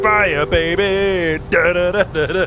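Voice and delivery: ordinary delivery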